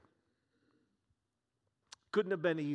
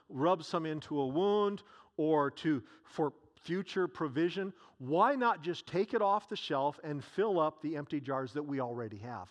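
ordinary talk

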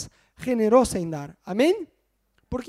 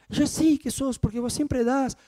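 imitating his wife; 6 semitones higher than usual. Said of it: "Muchas veces Ana me dice".

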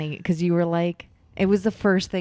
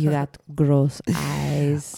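slow teasing; 4 dB louder, much slower than usual. Acted.

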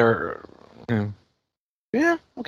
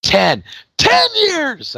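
high yelled ten years; Jeremy Piven impression; much slower than usual. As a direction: shout it like a stunned old classmate. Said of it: "I always do the Jeremy Piven thing".

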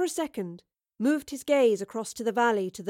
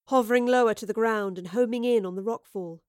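ordinary delivery, nothing marked

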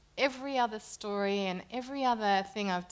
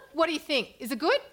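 higher and louder, a lightly voiced eager friend; 9 semitones higher, 8 dB louder than usual. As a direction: imitate a pushy friend, pitched up. Voicing Jenna's phone call.

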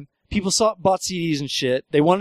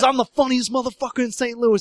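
higher, cocky boast lightly voiced; bragging stranger; 6 semitones higher than usual. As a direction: brag like you own the town. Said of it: "He goes".

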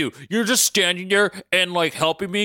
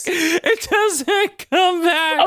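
"It doesn't come back" stretched very slow, high and laughing; laughing drawl; much slower than usual. Real, not acted. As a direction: drag it out through laughter, amused. Repeats line, second drawn out.

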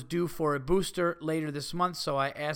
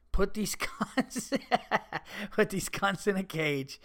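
slow and higher, breaking into laughter mid-line; can't get the insult out without cracking up; mock outrage dissolving into giggles; reading out a furious insult; 4 semitones higher, slower than usual.

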